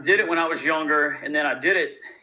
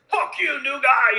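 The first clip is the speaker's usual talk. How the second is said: hostile bar backs; 10 semitones higher, 4 dB louder, slower than usual. Retold or imitated. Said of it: "Bar backs like".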